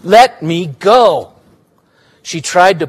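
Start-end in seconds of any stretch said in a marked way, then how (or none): 0.0-1.3 slow demand. Acted. Girl struggling against Willa.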